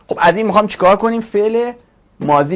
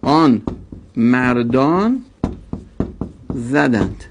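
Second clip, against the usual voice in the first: slow translation; 4 semitones lower, much slower than usual. Shown as a demonstration. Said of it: "slow translation".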